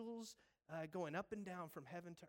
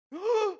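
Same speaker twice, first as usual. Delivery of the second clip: loud acted gasp; 19 dB louder than usual. Nathanael realizes who Jesus is.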